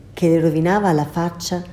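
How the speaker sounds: usual speaking voice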